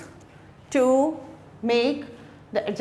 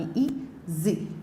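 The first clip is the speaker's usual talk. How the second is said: ई and जी said slowly as two separate sounds; syllable by syllable; 5 semitones lower, 7 dB quieter, much slower than usual. Shown as a demonstration. Teacher showing two vowel sounds, ई, जी split slowly. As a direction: break easy into ई, pause, जी, softly. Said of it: "दो vowel sound आ रही".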